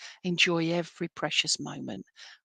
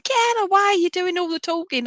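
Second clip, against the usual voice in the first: grandad; 10 semitones higher, 5 dB louder than usual. Retold or imitated. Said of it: "he's going".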